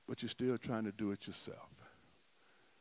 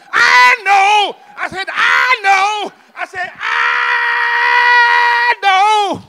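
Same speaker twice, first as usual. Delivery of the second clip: high, loud shouted climax; climactic shout; 24 dB louder, much slower than usual. Shown as a demonstration. Preacher shouting the sermon's climax to the church. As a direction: shout it to the rafters.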